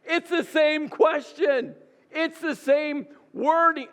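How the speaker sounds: high, drawn-out exasperation; exasperated. Acted. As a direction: stretch each phrase out high in disbelief. Exasperated at God repeating himself.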